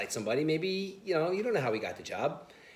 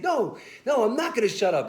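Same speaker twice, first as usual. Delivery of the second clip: high, louder mock yell back; retold scream; 9 semitones higher, 4 dB louder than usual. Player yelling back at the lineman, high mock yell. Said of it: "still screaming at Jeff Saturday".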